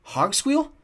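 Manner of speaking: high, rising question; astonished, pitch leaping up; surprised. A narrator's reading. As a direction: exclaim in startled recognition. Jared surprised to recognize the goblin.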